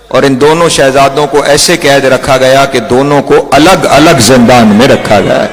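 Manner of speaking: ordinary talk, nothing marked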